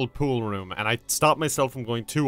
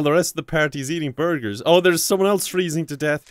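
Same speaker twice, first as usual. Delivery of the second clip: ordinary talk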